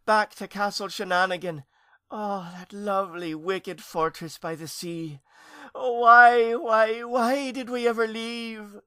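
high, drawn-out homesick wail; moaning lament. A narrator's reading. Aunt pining for her old castle, lamenting. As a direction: lament it, stretching the last words. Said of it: "began a long lament".

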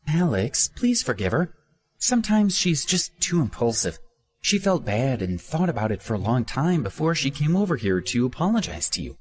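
high, earnest pleading friend. A narrator's reading. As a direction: beg on her friend's behalf. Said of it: "Hannah pleaded".

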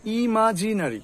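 ইমাজিনারি ending in open "na-ri"; full "a" before ri in ইমাজিনারি; common mispronunciation. Shown as a demonstration. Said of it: "কি হবে".

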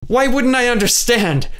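Kay shouting back at Joelle in frustration; shouted. A narrator's reading. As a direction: throw the question back as a shout. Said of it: "Kay shouted".

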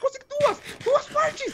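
very high squeaky report; frantic excitement. Real, not acted. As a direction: squeal the news mid-fight. Reporting loot during panic. (voz agudíssima, afobada)

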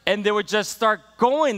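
normal talking voice

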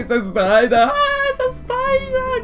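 high, loud, frantic shrieks about the spider; panicked screaming. Retold or imitated. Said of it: "I was just, like, going".

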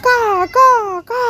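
high, separated crow caws. Shown as a demonstration. Showing the child how a crow calls, cawing. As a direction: caw like a crow, one call at a time. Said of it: "காக்கா அப்படி கூ கூன்னு கத்தாது".

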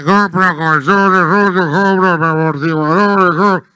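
very loud, droning vendor's chant; street buyer's call. Acted. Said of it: "por qué no te compró un micrófono". (pregón de comprador ambulante, a todo volumen)

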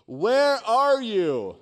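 slow, high, drawn-out shout; yelling down the shaft. Retold or imitated. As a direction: holler it slowly down to someone trapped. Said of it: "they yell down the shaft".

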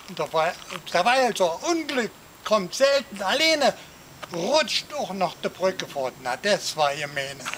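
ordinary talk, nothing marked

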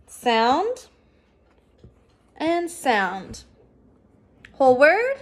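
each sound drawn out with a rising tune, then the word. Shown as a demonstration. Teacher leading the class sound by sound, rising each time. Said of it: "read these sounds with me".

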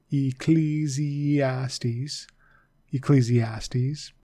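"Ecclesiastes" stretched very slowly, long E sounds; slow, careful. Shown as a demonstration. Teacher repeating "Ecclesiastes" slowly so students catch it. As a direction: spell it out with your voice, slowly. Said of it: "the E is long there".

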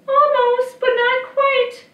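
put-on very high, loud child voice; slow, singsong high boy impression. A narrator's reading. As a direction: pipe back like a little boy. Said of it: "with the voice of the boy".

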